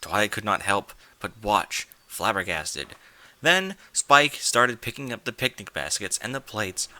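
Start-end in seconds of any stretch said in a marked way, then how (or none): none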